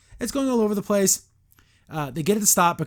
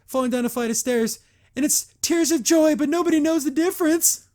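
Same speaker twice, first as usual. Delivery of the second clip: high, loud mock-emotional outburst; hammed-up confession; 9 semitones higher, 5 dB louder than usual. Imitating a character confessing under a truth spell, high hammed-up voice. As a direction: build higher and louder, overacting the confession. Acting out Double Trouble's confession.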